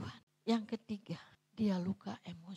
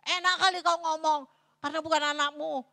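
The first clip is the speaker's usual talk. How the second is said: blunt Batak retort; 5 semitones higher, 7 dB louder than usual. Retold or imitated. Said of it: "Orang Batak kalau ngomong ceplos-ceplos".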